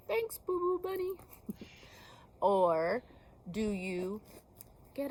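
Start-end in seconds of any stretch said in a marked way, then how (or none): 0.1-1.1 cute thank-you voice. Shown as a demonstration.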